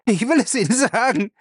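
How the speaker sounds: very high-pitched cry of surrender; panicked yell, pitch shooting up; desperate bellow. A narrator's reading. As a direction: cry out as you give in. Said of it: "brüllte er dann".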